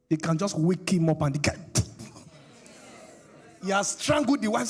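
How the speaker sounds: ordinary talk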